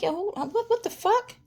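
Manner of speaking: high-pitched, stammering disbelief; retold bewilderment. Woman recalls waking up with no idea what happened, high and bewildered. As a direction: stumble into a high, baffled question. Waking confused, retells shocked reaction.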